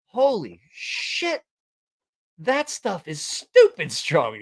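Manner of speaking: slow, drawn-out retold awe; stunned amazement. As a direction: stretch each word in acted disbelief. Retelling hungover amazement.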